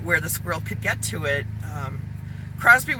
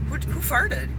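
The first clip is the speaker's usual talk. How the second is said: innocent dog voice; 9 semitones higher, 4 dB quieter than usual. Retold or imitated. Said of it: "he'll look around the room like".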